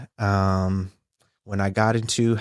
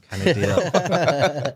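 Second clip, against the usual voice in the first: laughing; 5 semitones higher, much slower than usual. Real, not acted. Laughs at own joke.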